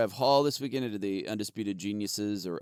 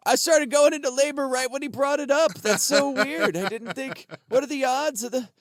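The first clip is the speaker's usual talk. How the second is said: high, hammed-up falsetto; mock heckler; 13 semitones higher, 5 dB louder than usual. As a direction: squeak it like a flustered stranger. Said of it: "they riffed on it".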